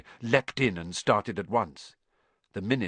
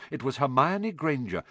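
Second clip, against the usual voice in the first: usual speaking voice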